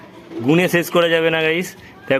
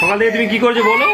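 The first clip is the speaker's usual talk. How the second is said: laughing, pressing; 8 semitones higher than usual. Real, not acted. Mother repeats question, louder.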